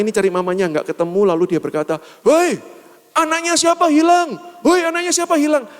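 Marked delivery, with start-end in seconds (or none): 2.3-5.7 very high, hollering auntie impression; market auntie calling out. Market woman asking whose child is lost, high imitated holler. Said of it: "tacik-tacik pasar atom".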